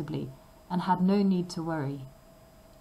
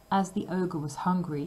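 plain reading voice

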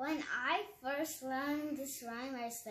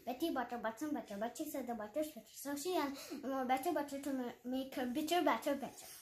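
tongue twister at full speed; quick, smooth, no stumbles; fast practiced version; faster than usual. Shown as a demonstration. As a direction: rattle it off fast. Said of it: "this is how it will sound".

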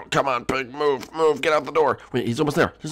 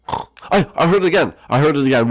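higher, louder startled outburst; startled alarm; 6 semitones higher, 5 dB louder than usual. Pretend. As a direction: blurt it out in alarm. Startled by the noise again.

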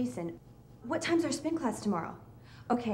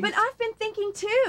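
high, loud, insistent; angry protest; 11 semitones higher, 7 dB louder than usual. Acted. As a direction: push back hard. Couple arguing.

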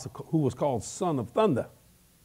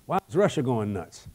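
ordinary talk

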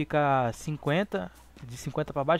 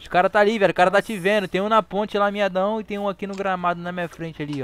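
urgent callouts; 3 semitones higher, 6 dB louder than usual. Real, not acted. Urgent callouts under fire.

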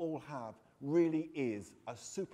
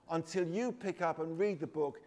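usual speaking voice

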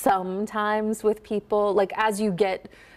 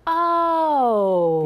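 loud, high, drawn-out "Oh" of realization; an idea dawning, gliding slowly through the vowel; having an idea; 6 semitones higher, 5 dB louder than usual. Shown as a demonstration. Coach cueing actors to find the diphthong, long dawning-idea "Oh". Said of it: "when you are having an idea".

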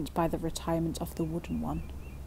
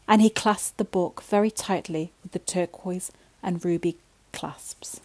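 ordinary delivery

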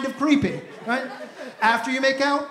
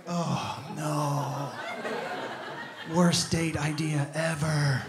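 mock dread; 8 semitones lower, 9 dB quieter, much slower than usual. Comic dread at mall date.

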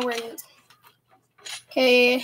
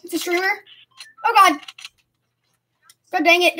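normal talking voice

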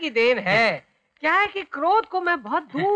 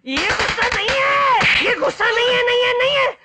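furious shouting; 8 semitones higher than usual. Acted. Enraged man screams at the waiter to admit he isn't angry. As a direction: scream it into his face.

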